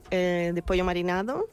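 usual speaking voice

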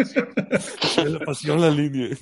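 high, loud, laughing echo. Real, not acted. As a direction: laugh through the words. Echoing joke while laughing.

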